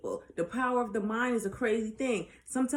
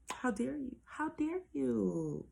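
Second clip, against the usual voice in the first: soft, higher-pitched scolding, drawn out at the end; mock reproach; 4 semitones higher, 7 dB quieter, slower than usual. Mock reproach after an emphatic rant.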